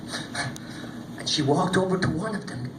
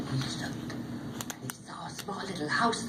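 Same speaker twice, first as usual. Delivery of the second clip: hushed; 11 dB quieter than usual. A narrator's reading. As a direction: draw them in quietly. Suspense in the telling.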